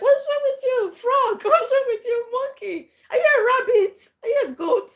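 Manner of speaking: put-on high, loud childish taunting voice; mock kids trading insults in the playground; shrill playground taunts. Kids in the playground taunting each other, high and loud.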